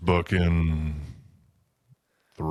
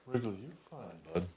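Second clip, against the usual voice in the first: talking to the dog; 3 semitones higher, 14 dB quieter than usual. Real, not acted. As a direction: coo gently to the dog.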